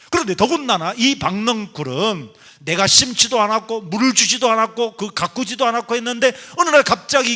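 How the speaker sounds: loud, high, insistent sermon delivery; impassioned preaching. Real, not acted. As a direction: drive each phrase home to the congregation. Preaches forcefully to the congregation.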